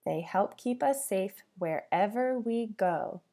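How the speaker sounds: ordinary talk, nothing marked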